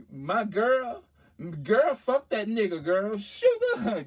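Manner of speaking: high, loud mock outrage; indignant girlfriend. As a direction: flare up on a friend's behalf, acted.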